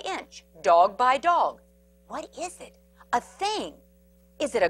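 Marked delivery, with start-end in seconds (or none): 2.1-2.8 soft, wary question; nervous hush. Acted. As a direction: ask it barely above a breath. Prairie dogs creep toward thing.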